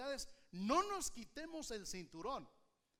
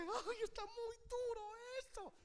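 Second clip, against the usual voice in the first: much higher, whining sigh; mock whimper about hard times; whiny complaint; 11 semitones higher than usual. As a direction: moan it like someone overwhelmed. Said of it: "cuando tú sientas que".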